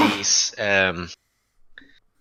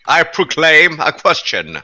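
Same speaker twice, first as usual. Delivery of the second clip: higher, louder, slow town-crier delivery; mock proclamation; 6 semitones higher, 5 dB louder, slower than usual. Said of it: "Yeah, not out loud".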